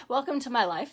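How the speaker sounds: quick, laughing delivery; real laughter at the chaos. Real, not acted. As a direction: laugh it off, amused. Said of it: "I'm giggling".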